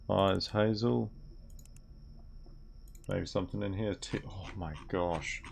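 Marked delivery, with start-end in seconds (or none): none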